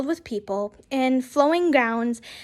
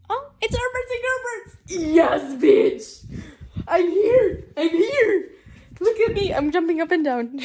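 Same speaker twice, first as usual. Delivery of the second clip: giddy, higher-pitched squeal through laughter; excited outburst; 4 semitones higher than usual. Real, not acted. Reader interrupting herself over a favourite thing, excited. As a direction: burst out in fangirl glee.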